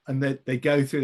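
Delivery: normal talking voice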